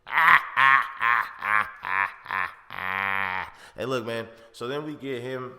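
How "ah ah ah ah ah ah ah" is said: loud mock yells that fade away; imitated crazed yelling. Norman running up the alley in the green suit, crazed fading yells. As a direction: yelp like a madman fleeing down the alley.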